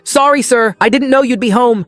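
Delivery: high, loud startled apology. A narrator's reading. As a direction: blurt it out in fright.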